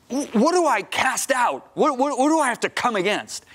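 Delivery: high, urgent plea to God; pleading prayer. Retold or imitated. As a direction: beg for an answer, pitch well up. Retold desperate prayer to God.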